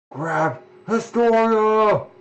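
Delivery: shouted order. Acted. Acted order in game scene.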